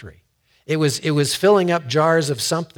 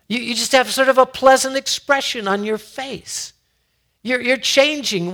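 acted onlooker, higher and louder; raised, wondering voice; mild amazement; 10 semitones higher, 5 dB louder than usual. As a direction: play someone puzzled by a friend's change. Others remark on someone's change.

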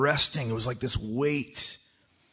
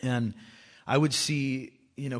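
ordinary talk

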